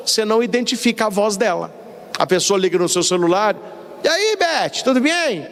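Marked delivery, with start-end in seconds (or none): 4.0-5.5 high, chummy phone greeting; over-familiar caller. Acted. Unknown caller greeting Beth on her cellphone, over-familiar. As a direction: play an overly familiar caller, pitched up. Acted phone call from acquaintance.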